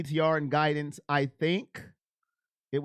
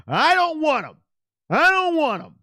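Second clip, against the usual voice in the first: much higher, louder, a shouted refusal; emotional outburst; 11 semitones higher, 9 dB louder than usual. Acted. Streamer voices his own emotions rejecting the quarterback, shouting. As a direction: blurt it out like pure gut feeling. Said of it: "And my emotion says".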